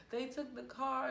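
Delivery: high-pitched mock panic; retold hyperventilating panic. Daughter telling mom about the stolen car, imitated panic. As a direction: imitate being worked up and short of breath. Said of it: "I'm all hyperventilating".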